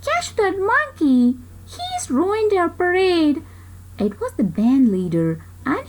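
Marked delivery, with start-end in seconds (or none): 0.0-3.4 mild read-aloud yell, higher; gently hammed-up shout. A narrator's reading. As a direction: call out after the monkey, pitched up. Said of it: "someone yelled".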